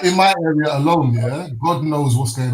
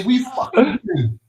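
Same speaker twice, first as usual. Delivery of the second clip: slow, high fake British line; British accent demo; 10 semitones higher, slower than usual. Shown as a demonstration. Friend showing the others the line to copy, slow and high. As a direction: drag it out high in a mock accent.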